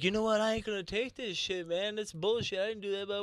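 high indignant complaint; player complaining. Retold or imitated. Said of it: "he's like".

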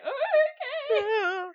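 high, loud, drawn-out whimper; mock tearful okay. Taking mom's search advice, exaggerated tearful agreement. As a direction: stretch it into a hammed-up sob. Retold tearful answer to mom.

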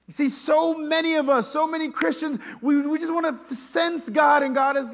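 loud, high, impassioned sermon voice; full-force preaching. Real, not acted. Preacher on wanting to feel God, loud and high.

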